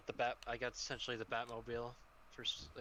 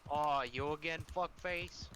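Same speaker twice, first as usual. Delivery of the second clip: exaggerated Canadian accent, higher; 3 semitones higher, 4 dB louder, slower than usual. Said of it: "in your Canadian accent".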